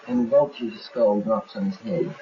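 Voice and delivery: ordinary talk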